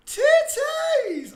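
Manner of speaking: high, loud drawn-out yell; sudden shout. Real, not acted. Host shouts a silly word mid-greeting, loud high yell.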